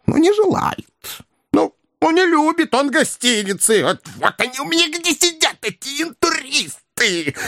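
high, squeaky confidential whine; intimate complaint. A narrator's reading. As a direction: complain in a high put-on character voice. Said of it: "Интимно пожаловался Коровьев". (жалобно, доверительно, высоким голоском)

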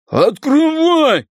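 high, slow, loud bark. Acted. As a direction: bark the command. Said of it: "рявкнул Рыгало".

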